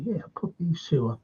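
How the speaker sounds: hushed whisper. Retold or imitated. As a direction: whisper it like a secret. Coaches conferring on the touchline.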